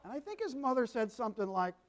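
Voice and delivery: ordinary delivery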